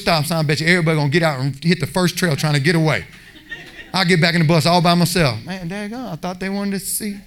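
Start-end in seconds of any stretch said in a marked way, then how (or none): 5.4-7.2 disappointed mutter. Retold or imitated. Alone on the bus, disappointed.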